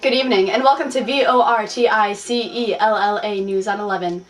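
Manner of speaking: formal newscaster read; news anchor. Acted. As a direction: open the broadcast like an anchor.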